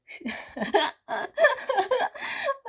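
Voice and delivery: sudden high-pitched laugh; high giggle burst. Real, not acted. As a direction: break into giggles. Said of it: "I'm laughing".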